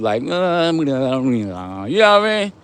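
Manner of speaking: exaggerated lazy, whatever-happens voice; laissez-faire attitude. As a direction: act out someone drifting along, words stretched out lazily. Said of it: "just with a laissez-faire attitude".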